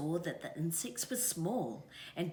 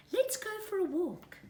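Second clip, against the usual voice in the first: high, bright mother-bird voice; mother kiwi; 14 semitones higher, 4 dB louder than usual. A narrator's reading. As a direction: coax the little one, pitched up. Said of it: "said his mother".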